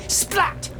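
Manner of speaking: sudden high-pitched "splat"; exclaimed splat. Retold or imitated. Storyteller mimicking a snowball hitting his neck, exclaimed splat. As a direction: punch out the snowball's impact, high and abrupt.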